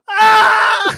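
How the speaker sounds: high loud yelp of pain; mock pained yell. Cop scalded by coffee, hammed-up yell. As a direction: yelp as hot coffee spills. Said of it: "and he goes".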